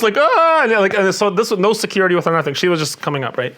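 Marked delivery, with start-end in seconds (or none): 0.2-0.6 surprised squeal. Retold or imitated. Singer mobbed by fans.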